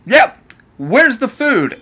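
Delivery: high, slow carefree Luffy voice. Retold or imitated. Recapping to viewers, Luffy ignoring danger, high and slow. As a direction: drawl it out, totally unbothered. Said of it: "he's like".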